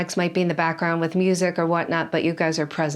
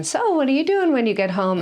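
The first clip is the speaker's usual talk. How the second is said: put-on higher, sing-out question to kids; asking children; 7 semitones higher than usual. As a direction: ask it like you're checking in with children. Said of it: "I have to sometimes ask the children".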